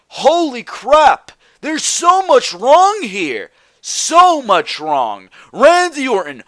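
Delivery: high-pitched, drawn-out exasperation; exasperated outburst. Real, not acted. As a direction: cry out in real disbelief, stretching the words. Exasperated rant about the show.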